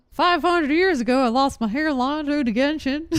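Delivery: put-on southern accent. Streamer joking to chat about losing hairline to Genshin, mock Southern accent. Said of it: "why I gave it that accent".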